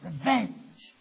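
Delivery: loud, slow, rising preacher's roar; preaching at full force. Acted. Preacher crying out to the congregation at full force. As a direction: build the sermon to a shouting climax.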